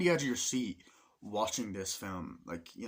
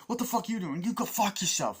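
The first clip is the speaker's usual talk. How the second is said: high, louder furious yell, imitating; mock angry shout; 10 semitones higher, 5 dB louder than usual. Soldiers squaring off in the film, mock furious yell. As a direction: snap it at him. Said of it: "they're like".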